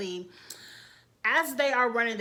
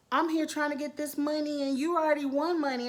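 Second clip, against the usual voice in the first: higher, whiny put-on voice imitating Bailey; mock whining; 5 semitones higher than usual. Imitating Bailey whining to Dee about money, mock whiny. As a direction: whine it like a sulky contestant. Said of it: "Bailey was doing a lot of whining".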